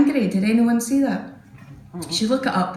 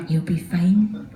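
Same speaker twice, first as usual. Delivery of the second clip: whispered reassurance; 4 semitones lower, slower than usual. Retold or imitated.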